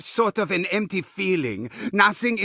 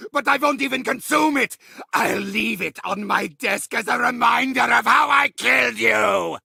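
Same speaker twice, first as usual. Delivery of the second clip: higher, shouting villain rage, spitting every threat; furious ranting villain; 3 semitones higher than usual. Acted. Villain raging at players who keep surviving, furious shouting. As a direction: scream threats at the worms who won't die.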